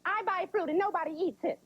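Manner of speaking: high-pitched mom lament; complaining mom. Retold or imitated. Mom griping about uneaten fruit, high complaint. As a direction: whine it high like an aggrieved mother. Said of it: "they say weird things like".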